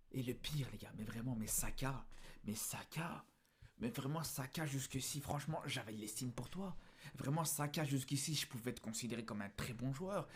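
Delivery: soft, low disappointment; hushed, low reproach to a player; let-down, subdued. Real, not acted.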